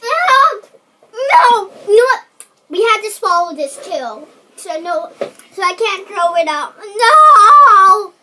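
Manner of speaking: high loud distressed shouts; distressed shouting. Real, not acted. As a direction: protest loudly, near tears. Forced to swallow bad bean.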